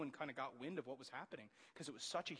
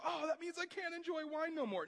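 mock dismay; 13 semitones higher, 5 dB louder than usual. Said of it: "Some of us go".

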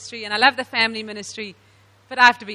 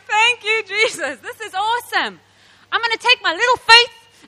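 higher, excited thanks to Jesus; thankful joy; 8 semitones higher, 3 dB louder than usual. Retold or imitated. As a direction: burst out in grateful joy. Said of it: "I was like".

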